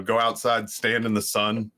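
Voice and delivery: usual speaking voice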